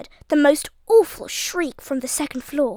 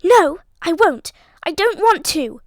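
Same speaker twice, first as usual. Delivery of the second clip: put-on terrified girl pleading, no, no; frantic refusal; 4 semitones higher, 5 dB louder than usual. A narrator's reading. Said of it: "Beanie gasped".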